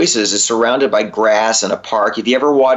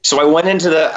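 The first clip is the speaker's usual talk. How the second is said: ordinary delivery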